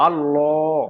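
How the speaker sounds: আল্লাহ with lips rounded, heavy lam; rounded-mouth Allah. Shown as a demonstration. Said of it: "আল্লাহ মুখটা একটু গোল হয়ে আছে".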